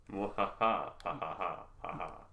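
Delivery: slow evil cackle; mad scientist. Pretend. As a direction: cackle like a gleeful mad villain. Hyena mask, villain laugh.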